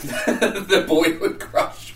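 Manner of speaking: laughing. Real, not acted. Laughing at own crush.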